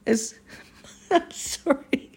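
laughing helplessly mid-story; can't stop the giggles while reading; breaking up with real laughter. Real, not acted. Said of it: "I can't help it but laugh".

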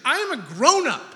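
high, loud mock defiance, half laughing; laughing mock tantrum, high and loud; mock defiant grown-up. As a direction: stamp your foot like a stubborn kid, laughing. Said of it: "This has been my mantra".

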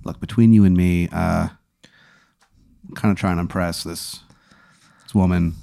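hushed, hesitant, low aside; quiet, halting appeal to a clerk; confidential lean-in. Acted. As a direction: lean in and speak softly, just between two. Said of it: "I kinda lean in".

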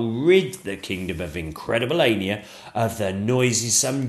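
a pompous dragon laying down his claim. Acted. Dragon boasting to the royal family, loud and pompous. Dragon voiced in read story.

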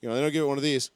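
normal talking voice

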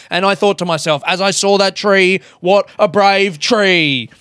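hammed-up race caller telling a personal story; horse announcer. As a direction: keep the announcer's driving chant going. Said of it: "That's the only way he knows how to tell a story".

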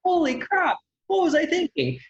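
very high, aghast self-reproach; mock disbelief. Acting out regret over an almost-sent email, comically appalled. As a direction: squeal it as if appalled at yourself. Rereading the angry email next day.

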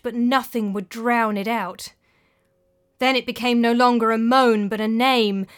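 plain reading voice

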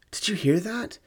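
high, startled blurt; alarmed blurt. A narrator's reading. Mother startled at the tracks asking her daughter, high alarmed blurt. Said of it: "I blurted".

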